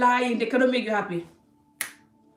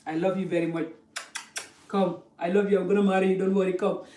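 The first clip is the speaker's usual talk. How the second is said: coaxing suitor; 3 semitones lower than usual. Retold or imitated. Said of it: "they're gonna tell you".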